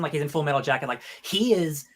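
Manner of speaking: ordinary talk, nothing marked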